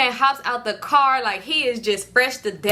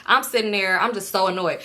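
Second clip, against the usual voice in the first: ordinary talk, nothing marked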